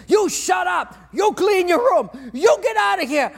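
high, loud hammed-up yell; mock yelling at kids. Parent yelling at kids at home, hammed-up mock rage. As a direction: bark orders at the kids in mock fury. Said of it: "you're yelling at your kids".

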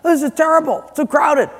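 high-pitched mock outrage; upset complaining. Churchgoer complaining to God about the crowd, upset. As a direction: protest like a fussy churchgoer. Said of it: "we'll get a little upset".

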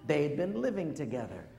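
usual speaking voice

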